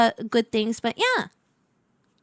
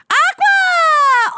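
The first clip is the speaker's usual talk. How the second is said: high, loud excited call; excited shout; 14 semitones higher, 5 dB louder than usual. Real, not acted. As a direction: shout the name out gleefully. Calling out a viewer's name.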